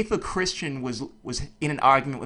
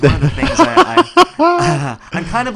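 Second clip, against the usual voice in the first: high, loud laugh breaking through the words; can't hold back the laughter as he talks; 10 semitones higher, 12 dB louder than usual. Real, not acted. Podcast host laughing at his own point to his guest. Laughs mid-sentence while explaining.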